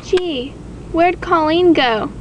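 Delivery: ordinary talk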